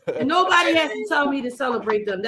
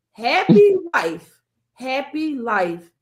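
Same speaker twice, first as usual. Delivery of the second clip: very slow, drawn-out saying; deliberate quoting; much slower than usual. Shown as a demonstration. Host sets up her last question with a saying, slow and deliberate. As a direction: stretch out each word of the saying.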